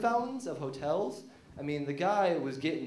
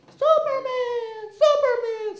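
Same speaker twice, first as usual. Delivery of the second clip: very high, loud, slow moaning call; Lois calling; 11 dB louder, slower than usual. Retold or imitated. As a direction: wail his name like a lover. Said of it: "he heard Lois going".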